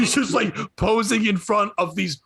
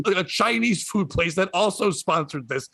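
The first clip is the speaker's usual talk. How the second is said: ordinary delivery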